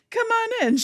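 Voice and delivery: a happy little guide waving you in; bubbly host. Retold or imitated. The inner child greeting guests, high and bubbly. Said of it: "She's like the guide".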